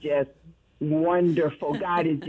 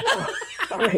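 laughing; 11 semitones higher than usual. Real, not acted. Caller overwhelmed, laughing.

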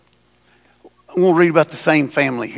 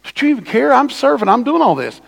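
ticked-off Martha; 6 semitones higher than usual. Retold or imitated. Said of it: "She said".

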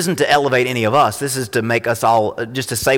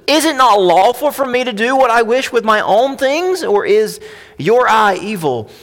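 high, loud, challenging pulpit reading; scripture reading; 11 semitones higher, 5 dB louder than usual. A narrator's reading. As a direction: press each question on the listeners. Reads the landowner's challenge.

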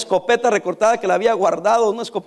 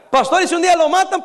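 higher, asking the pastor in worry; worried questioner; 8 semitones higher than usual. Retold or imitated. Congregant asking the pastor, a touch of worry. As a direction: voice a concerned churchgoer.

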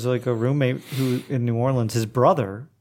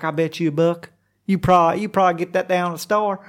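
higher Okie twang, imitating mom; mom's Okie accent; 5 semitones higher than usual. Quoting mom making a bet, Okie accent. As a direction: do mom's Okie talk, pitched up. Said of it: "she does".

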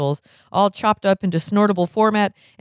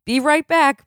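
higher, hurried dentist; eager, rushing off; high eager doctor; 10 semitones higher than usual. Acted. Blatnoyd rushing out.

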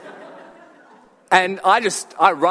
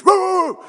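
sudden high loud mock dog woof; dog's bark imitated; 9 dB louder than usual. Dog barking from a balcony at passers-by, loud mock woof. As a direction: bark it out like the dog above you. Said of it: "hear this".

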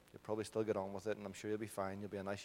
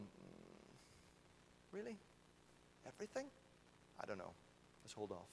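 very soft, reluctant hesitation; doubtful mumbling; 18 dB quieter than usual. Acted. People answering God's promise with doubt, hesitant and hushed. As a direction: imitate someone hedging, barely audible.

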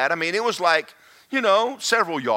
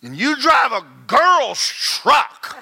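slow, loud sneering jab at the truck; mock him the way he sneered it; mocking taunt; 8 semitones higher, 5 dB louder, slower than usual. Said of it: "he says".

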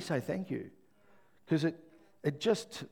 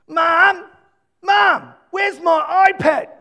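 yelling across the room; 19 dB louder, much slower than usual. Retold or imitated. Child calling mum from bed.

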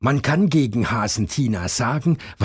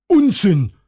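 high loud scoff; loud high rabbit retort; calling out; 11 semitones higher, 7 dB louder than usual. A narrator's reading. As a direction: snap it back loud and high. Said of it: "ruft Hasen Tom".

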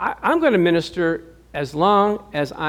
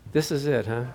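wry letdown; 4 semitones lower, 7 dB quieter than usual. Retold or imitated. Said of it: "she goes".

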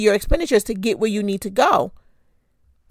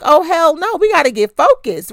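higher, half-joking outburst of refusal; retold outrage; 7 semitones higher than usual. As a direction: replay your own shocked no at the bill. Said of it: "I was like".

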